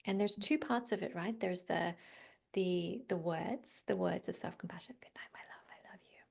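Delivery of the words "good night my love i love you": put-on tender whisper, much softer, like soothing a child to sleep; loving whispered goodnight. Shown as a demonstration. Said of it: "say goodnight to yourself in a loving way".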